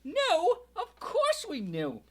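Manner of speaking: high, loud, near tears; bitter rant. A narrator's reading. Aunt Petunia rants at Harry, loud and high, almost crying. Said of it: "went on ranting".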